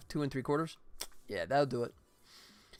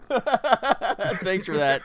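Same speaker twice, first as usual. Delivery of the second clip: slow, laughing thanks; 6 semitones higher, 9 dB louder, much slower than usual. Real, not acted. Thanks a viewer while laughing.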